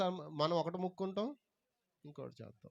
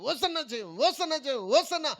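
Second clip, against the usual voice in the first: crowd cheering; 9 semitones higher, 4 dB louder, slower than usual. Retold or imitated. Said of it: "అక్కడేమో గట్టి కేకలు వస్తున్నాయి".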